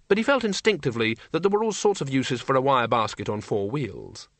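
usual speaking voice

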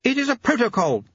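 high, snapped retort; high-pitched curt ladyship. A narrator's reading. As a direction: snap it in a high lady's voice. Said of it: "snapped Lady Lejeune".

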